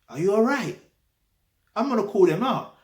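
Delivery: high, loud, incredulous indignation; indignant outburst. Real, not acted. Man rejected even by McDonald's, indignantly outraged.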